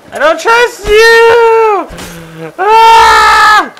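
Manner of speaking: very high, loud, drawn-out shriek; piercing panicked shout; startled scream. Real, not acted. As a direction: scream your distrust in alarm. Startled by threat in game.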